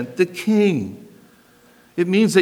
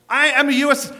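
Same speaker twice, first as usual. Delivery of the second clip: mock American accent, a lot higher and a bit louder; exaggerated American accent; 11 semitones higher, 4 dB louder than usual. Shown as a demonstration. Preacher mimicking an American at passport control, hammed-up American accent. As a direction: show off a broad American accent, pitched up. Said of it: "listen to this accent".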